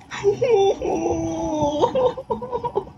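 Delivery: a long high-pitched giggle; high gleeful laugh. Real, not acted. Gamer watching the pocket close, gleeful high laugh. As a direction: cackle with delight.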